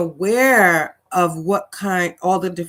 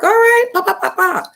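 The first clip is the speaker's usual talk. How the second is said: playful high cheer; 14 semitones higher, 4 dB louder than usual. Real, not acted. Playful cheer for New York viewer.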